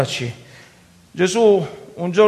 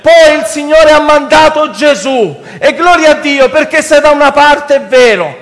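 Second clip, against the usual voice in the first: impassioned, raised-pitch sermon; preaching; 6 semitones higher, 6 dB louder than usual. Real, not acted. Preacher asking brothers why they wait to cry out, preaching. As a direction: challenge the congregation with full force.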